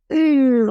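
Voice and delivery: a high, loud "ooh" of sudden pleasure; delighted ooh. Acted. Coach showing how joy catches you, a high delighted ooh. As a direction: let a happy "ooh" burst out. Showing contagious laughter's effect.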